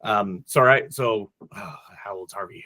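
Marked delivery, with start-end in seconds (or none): 1.5-2.6 quick breathy whisper under the breath; whispered aside. Real, not acted. Asking the co-host a quick question in a whisper. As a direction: lean in and whisper the question off-mic.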